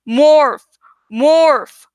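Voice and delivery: loud, forceful order to herself; urgent self-command. A narrator's reading. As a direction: push yourself with a sharp command.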